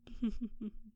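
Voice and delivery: a light mocking chuckle. Succubus teasing the mortal's desire, knowing laugh. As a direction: laugh as if you caught him out.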